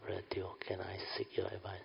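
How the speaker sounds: hushed confiding whisper. Retold or imitated. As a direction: whisper it like a secret. Student confiding in teacher.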